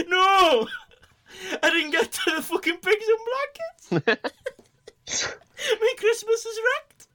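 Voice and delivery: high, loud hammed-up Geordie wail. [name] in a Geordie accent wailing over lost pigs in blankets. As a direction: wail it as a Geordie whose Christmas is ruined. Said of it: "Why are you Geordie?".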